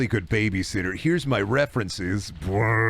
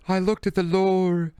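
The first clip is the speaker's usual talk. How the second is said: hammed-up horror character; 8 semitones higher than usual. Horror character discovering lore.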